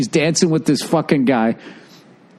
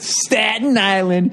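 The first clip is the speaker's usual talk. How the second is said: put-on higher, drawn-out Staten Island accent; 6 semitones higher, slower than usual. Couple finishing their joke line together, mock Staten Island accent. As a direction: draw it out like a Staten Island local. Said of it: "with that accent".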